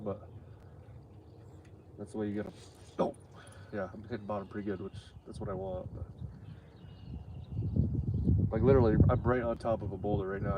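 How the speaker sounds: ordinary delivery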